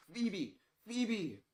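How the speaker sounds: high, loud calling-out; foghorn scream. A narrator's reading. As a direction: holler the name like a little foghorn. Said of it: "the boy with the foghorn voice".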